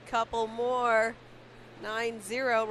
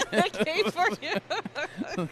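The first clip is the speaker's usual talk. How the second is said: laughing; 8 semitones higher, 7 dB louder, much slower than usual. Real, not acted. Teasing about crowd arriving.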